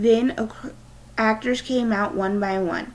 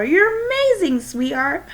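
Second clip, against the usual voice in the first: excited praise; 9 semitones higher, 5 dB louder than usual. Acted. Proud father hugging daughter.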